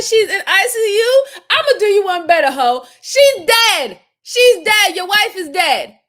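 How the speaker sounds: loud, heated yelling; furious yelling. Real, not acted. Yelling at the suspect on screen, loud furious rant. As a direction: shout her down in anger.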